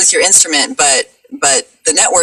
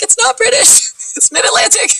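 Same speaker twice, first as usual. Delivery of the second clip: very high-pitched, squeaky comic protest; mock protest. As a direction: squeal it in playful indignation, breaking into laughter. Mock protest at bad reviews.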